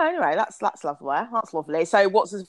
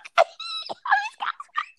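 very high-pitched excited squeal; giddy squeal. Acted. Host shows the thrill of being called girlfriend, squealing. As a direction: let out a tiny thrilled shriek.

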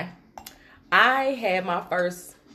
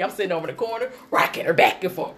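usual speaking voice

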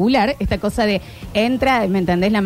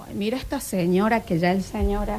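softer, sniping gossip voice; gossiping murmur; 2 semitones lower, 6 dB quieter than usual. Retold or imitated. Shoppers judging her, in a softer gossiping murmur. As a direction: mutter it like a judging bystander.

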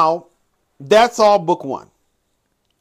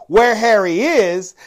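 normal talking voice

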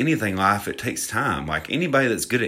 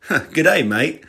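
hammed-up Australian greeting, high and loud at the start; punchy mock-Aussie greeting; 7 semitones higher, 5 dB louder, slower than usual. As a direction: put on a jokey Aussie accent. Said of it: "Are you from Australia?".

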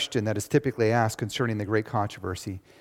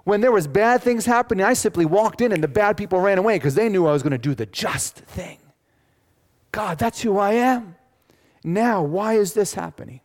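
much higher, louder pleading cry to God; frustrated Job; 9 semitones higher, 7 dB louder than usual. Acted. Job crying out in frustration at God. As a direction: cry out like a man at the end of his rope. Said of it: "Seriously saying".

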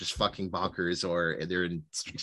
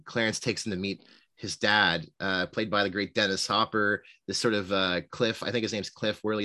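normal talking voice

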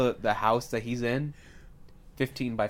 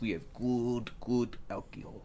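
mock Russian host, thick vowels; comic Russian cabbie; Russian accent. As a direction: sell it in a hammy Russian accent. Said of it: "He's Russian".

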